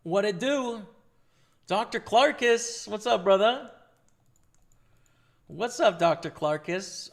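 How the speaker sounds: loud, hyped streamer shout-out; big excited welcome to a regular viewer; hype greeting. Real, not acted.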